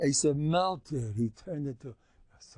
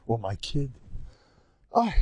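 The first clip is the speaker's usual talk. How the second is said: usual speaking voice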